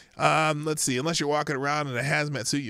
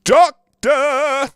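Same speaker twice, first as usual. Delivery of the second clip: DOCTOR hugely loud, high and drawn out; exaggerated Doctor; 11 semitones higher, 14 dB louder than usual. Shown as a demonstration. Host rereading a headline, grossly exaggerated stress on Doctor. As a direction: boom out Doctor in two long, mocking syllables. Mockingly blown-up title in headline.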